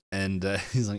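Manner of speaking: ordinary talk, nothing marked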